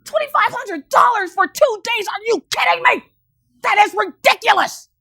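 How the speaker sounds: high, loud disbelieving outburst; shocked outrage. Retold or imitated. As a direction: squeal at the price. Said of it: "I was like".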